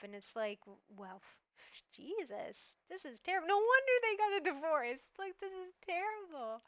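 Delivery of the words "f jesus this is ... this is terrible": higher, louder exasperated outburst; real outrage. Real, not acted. Reacting to a couple's advice video, outraged and exasperated. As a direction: let the disbelief burst out. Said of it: "I feel angry just even thinking about that".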